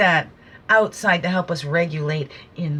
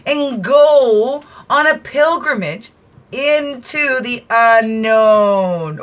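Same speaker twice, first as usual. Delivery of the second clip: slow, louder and higher declamation; dramatic reading; 4 semitones higher, 6 dB louder, slower than usual. Acted. Speaker reading a card to listeners, slow and declaiming. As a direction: read it like an oracle's proclamation.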